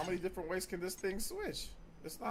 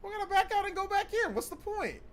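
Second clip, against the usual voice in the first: mocking repeat; 6 dB louder than usual. Real, not acted. Teammate repeats advice mockingly.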